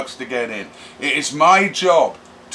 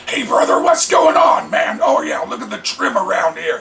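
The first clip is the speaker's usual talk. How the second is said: Corey's brother voice; 7 semitones higher, 4 dB louder than usual. Retold or imitated. Imitating Corey greeting his brother, high and loud. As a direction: ham up the laid-back rocker buddy. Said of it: "He talks like that".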